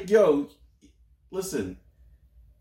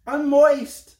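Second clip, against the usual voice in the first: high, loud mock-swooning fan; hammed-up fangirl voice; screaming fangirls; 9 semitones higher, 8 dB louder than usual. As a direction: squeal it like an overexcited girl. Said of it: "girls are gonna love you".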